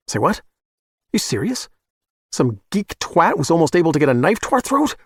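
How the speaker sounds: can't believe what he's hearing; incredulous. Acted. Client stunned by the killers' news, incredulous.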